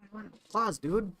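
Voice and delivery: high put-on cat voice; voicing her cat. Answering for her cat in a high whiny cat voice.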